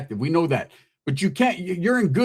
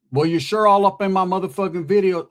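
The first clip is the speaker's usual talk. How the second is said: usual speaking voice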